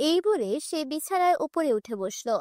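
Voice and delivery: usual speaking voice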